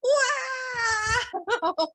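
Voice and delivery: high joyful shout while spinning; celebrating spins. Real, not acted. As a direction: whoop and laugh mid-spin. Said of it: "let me do my spins".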